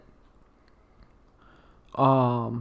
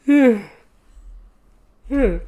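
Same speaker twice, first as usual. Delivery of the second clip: yawning; 11 semitones higher, 8 dB louder than usual. Real, not acted. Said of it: "I need to stop yawning".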